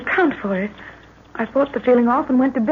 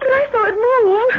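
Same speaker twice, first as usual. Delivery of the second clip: high, slow, fearful; frightened; 12 semitones higher, 3 dB louder than usual. Acted. Lorna to her father about the snake, high and fearful. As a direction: insist in fear.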